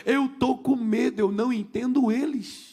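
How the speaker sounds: imitated child's wail, drawn out; retold tearful plea. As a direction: plead slowly as a frightened little boy. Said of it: "começou a chorar".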